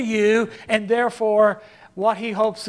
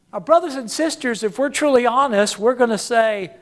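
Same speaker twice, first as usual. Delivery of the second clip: ordinary talk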